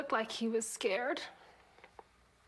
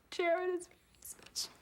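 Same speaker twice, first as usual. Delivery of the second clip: whispered; 7 semitones higher than usual. Real, not acted. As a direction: breathe it out as a secret. Mother confiding love for son.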